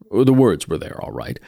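ordinary delivery, nothing marked

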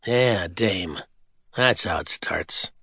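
low, slow hard-boiled aside; noir detective. A narrator's reading. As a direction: growl it like a tired gumshoe.

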